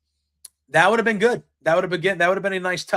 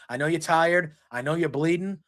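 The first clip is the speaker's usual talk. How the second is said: ordinary talk, nothing marked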